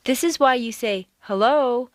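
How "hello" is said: "Hello" said as a tentative question; doubtful phone greeting. Shown as a demonstration. Answering the phone to an unknown caller, doubtful questioning "Hello". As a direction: answer with an unsure, higher "Hello". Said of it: "when answering the phone because you don't know who's".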